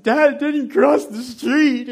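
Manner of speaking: very slow, high, loud wail; crying even harder. Retold or imitated. Boy sobbing a denial to his dad, wailing. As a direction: stretch the sob out to dad. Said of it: "I started crying even harder".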